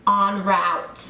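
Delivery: "En route" with route rhyming with "out"; "En route", American, ending in "rowt"; American pronunciation. Shown as a demonstration. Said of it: "But then Americans say en route".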